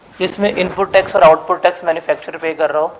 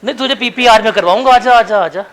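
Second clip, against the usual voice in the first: louder, higher, jokey coaxing; loud teasing; 3 semitones higher, 7 dB louder than usual. Real, not acted. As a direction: egg him on loudly in fun. Teacher loudly teasing hesitant student. (ज़ोर से छेड़ते हुए बुलाना)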